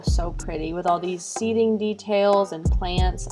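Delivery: usual speaking voice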